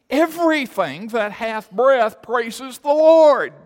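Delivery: loud, high, slow declaration; exultant preaching proclamation. Real, not acted. Preacher proclaiming praise from all creation, exultant full-force preaching. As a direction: ring it out and stretch the final word.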